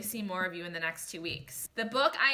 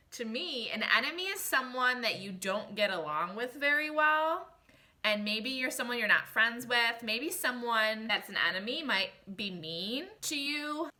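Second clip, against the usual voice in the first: ordinary delivery, nothing marked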